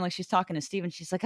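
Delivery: normal talking voice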